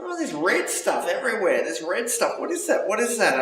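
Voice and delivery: puzzled then urgent, voice climbing; alarmed confusion. Retold or imitated.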